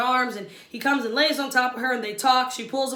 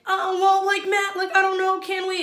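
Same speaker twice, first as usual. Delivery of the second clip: higher, timid girly imitation; imitating Katie; 7 semitones higher, 3 dB louder than usual. Vlogger imitating Katie asking for more time, higher. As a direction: mimic a hesitant contestant asking. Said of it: "And then she's like".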